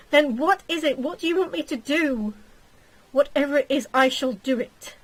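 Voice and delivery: loud, pressing plea; urgent pleading. Acted. Husband begs his crying fiancee to explain, loud and urgent.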